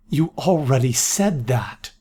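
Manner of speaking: ordinary delivery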